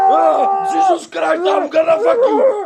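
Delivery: high shouted noes building to a scream; terrified screaming. Real, not acted. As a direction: scream at the attacker in fright. Screaming at attacker.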